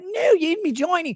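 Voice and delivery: high, squawked mock British woman; British accent. British prostitute contradicts her client, high mock British accent. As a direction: pitch it way up in caricatured British. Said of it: "he talks the same because they're British".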